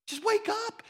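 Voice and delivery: high, pleading yell; mock yell. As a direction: call out to wake them. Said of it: "wanna yell".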